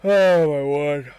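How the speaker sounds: slow, loud, disbelieving groan; exasperated. Real, not acted. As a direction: stretch it out in despair. Despairing at the result.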